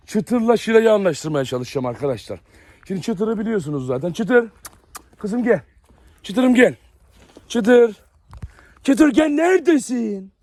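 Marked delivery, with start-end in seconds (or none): 4.1-8.0 high, louder call to the dog; sing-song call for his dog. Real, not acted. Calling the dog across the yard. 8.8-10.3 high, slow sing-song call; a coaxing call to a hiding dog; drawn-out dog call. Real, not acted.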